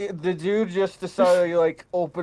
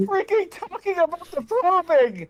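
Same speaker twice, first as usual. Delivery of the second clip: incredulous squeal; 12 semitones higher than usual. Real, not acted. Mocking the poem, laughing.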